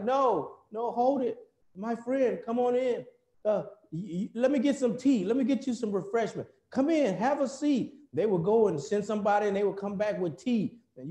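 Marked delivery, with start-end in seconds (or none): 0.0-7.9 high, bright-pitched eager welcome; welcoming host. Retold or imitated. Host greeting an American shopper, high and eager welcome. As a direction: reel the shopper in with a raised, beaming welcome.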